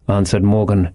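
ordinary delivery, nothing marked

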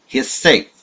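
high, loud gloating cry; triumphant cry. A narrator's reading. Old spy gloating that Franz escaped, triumphant cry. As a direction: crow it out in triumph. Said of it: "he cried".

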